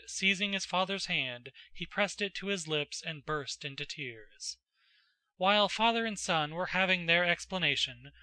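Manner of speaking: plain reading voice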